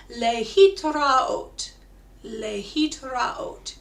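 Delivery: Lehitraot stretched out very slowly; very slow model. Shown as a demonstration. Teacher leads the group: Lehitraot, very slow. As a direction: say Lehitraot slowly so they can echo. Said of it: "try it together".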